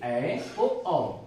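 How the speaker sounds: normal talking voice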